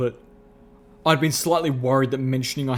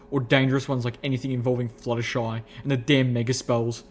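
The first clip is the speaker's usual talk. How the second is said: usual speaking voice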